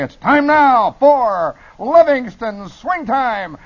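high, loud, stretched-out show opener; over-the-top radio hype; wildest jazz disc jockey. Acted. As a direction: belt it out as a wild swing DJ. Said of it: "I would be the jazziest knockout".

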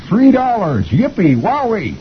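high, drawn-out squeal of glee; cheering kids. A narrator's reading. Kids cheering as the fund reaches its goal, cheering. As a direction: cheer like a bunch of little kids. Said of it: "all the kids are cheering".

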